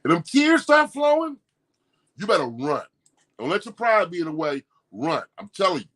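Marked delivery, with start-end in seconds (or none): 0.0-1.4 pitched way up, imitating someone about to cry; mock crying voice. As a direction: act out a teary, quivering person mid-argument. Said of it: "and they like this".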